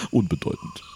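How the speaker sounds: leicht verstellte, kühle Bösewichtstimme; kaltes Abtun. Acted. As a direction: ein Hauch von eiskaltem Chef. Bösewicht tut Opfer ab. (leicht verstellte Stimme, kühl)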